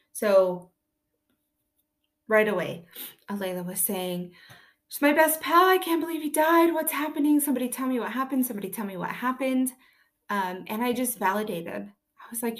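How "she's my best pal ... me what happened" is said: put-on upset child. Grieving daughter's distressed outburst retold.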